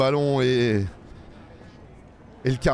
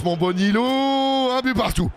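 excited cheer; 7 semitones higher than usual. Real, not acted. Penalty scored.

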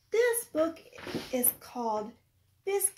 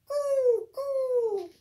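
pigeon coo; 7 semitones higher than usual. Acted. Teacher cooing as pigeons for a child, lightly.

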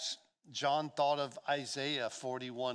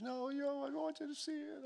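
very high imitation of an upset wife; mock-tearful insistence, pitched way up; retold tearful wife; 13 semitones higher than usual. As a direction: plead it in a high, put-on voice. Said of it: "she's crying".

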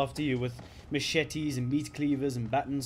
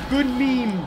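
loud, higher shout; shouted catchphrase; 11 semitones higher, 10 dB louder than usual. Acted. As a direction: belt out the catchphrase. Meme catchphrase shouted louder.

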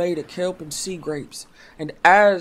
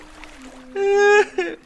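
sob; 8 dB louder than usual. Acted. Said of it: "Princess Bucktooth sobbed".

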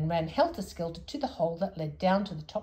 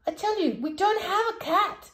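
higher, exasperated insistence; cross; 13 semitones higher, 5 dB louder than usual. Acted. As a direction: snap it out, fed up. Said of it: "getting quite cross".

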